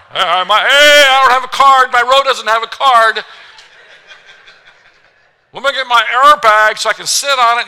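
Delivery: high, loud frantic passenger; mock panic, grabbing for the card; panicked passenger. Passenger mid-turbulence, frantic and high.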